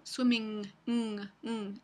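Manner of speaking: swimming ending in a velar ng; swimming, close on a back ng; ng held at the back of the mouth; English ng. Shown as a demonstration. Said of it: "existe en inglés".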